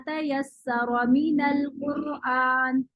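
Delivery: slow, drawn-out tajweed chant; slow melodic Quran recitation; Quranic recitation. Shown as a demonstration. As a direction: recite it slowly with measured elongation.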